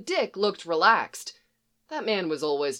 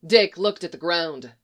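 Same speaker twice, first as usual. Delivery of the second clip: ordinary delivery, nothing marked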